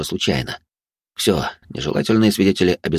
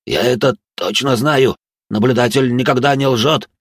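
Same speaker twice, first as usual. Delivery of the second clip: panicked; 6 semitones higher, 4 dB louder than usual. Acted. Terrified village elder insisting.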